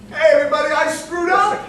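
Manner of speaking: loud, high call to the grandstands; mock confession shout. Batter tells the grandstands about his strikeout, loud mock shout. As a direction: bellow the blunder to the crowd, half laughing. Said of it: "turning around to the grandstands and saying".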